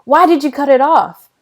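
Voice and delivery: high, loud accusing question; almost angry. A narrator's reading. Sister questions brother about cutting his braid, almost angry. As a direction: demand an answer, close to anger. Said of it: "almost like she was angry".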